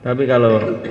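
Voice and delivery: usual speaking voice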